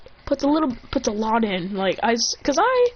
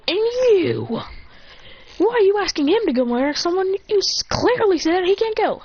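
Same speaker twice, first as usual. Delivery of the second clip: rip into him for his bad idea; angry scolding; 10 semitones higher than usual. Sakura scolding the other one.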